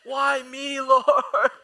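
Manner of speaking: long, loud, high-pitched mock wail; melodramatic death cry. Kid collapsing in cereal aisle, hammed-up agony. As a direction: wail as if shot in an action movie. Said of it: "like they've been shot in an action movie".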